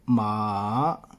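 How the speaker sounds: मा dips low then rises; dipping tone. Shown as a demonstration. Teacher shows students the dipping tone on मा. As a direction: bring मा down, then back up. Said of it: "फिर ऊपर गई है".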